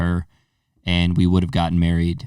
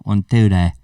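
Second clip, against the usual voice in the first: playful Scouse mic count; put-on Liverpool accent; 4 dB louder than usual. Podcaster testing the mic as a Brit, Liverpool accent. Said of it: "We could talk like this".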